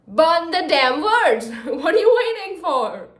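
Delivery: frustrated shout. Real, not acted. Reader shouting at Iris to burn the letters. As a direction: demand it in exasperation. Yelling at character.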